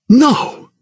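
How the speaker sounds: high-pitched cry of shock; horrified cry. A narrator's reading. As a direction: cry out in horror at the sight. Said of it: "he cried".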